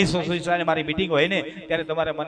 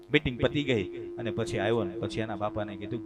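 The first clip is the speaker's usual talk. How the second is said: ordinary talk, nothing marked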